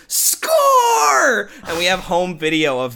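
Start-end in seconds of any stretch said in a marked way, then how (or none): 0.1-1.5 high and loud shriek; excited kid yell. Retold or imitated. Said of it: "Andy goes".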